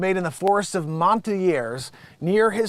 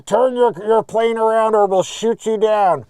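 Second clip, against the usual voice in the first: military warning; 4 semitones higher, 7 dB louder than usual. Acted.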